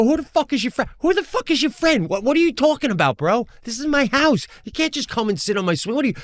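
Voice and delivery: high-pitched indignant rant at normal volume; mock yell, outraged and insistent; retold yelling. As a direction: act out berating a stranger, pitch way up. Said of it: "I'm like, I'm yelling".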